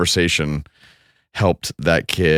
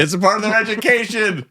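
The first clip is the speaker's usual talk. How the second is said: echoing mom. Retold or imitated. Son echoes mom's reply.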